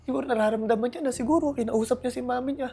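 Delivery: high, loud, tear-choked voice breaking as he retells it; words pushed out through real sobs; sobbing while talking. Real, not acted. Father recalling his son's forgiveness on air, crying hard. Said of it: "hagulgol ako".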